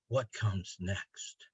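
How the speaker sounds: soft, slow close of the poem; hushed ending. Acted. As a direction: drop to a near hush.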